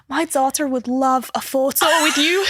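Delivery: imitated Scottish mum asking a favour; playful Scottish accent impression; thick Scottish accent. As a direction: put on a broad Scottish accent. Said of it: "with a really thick Scottish accent".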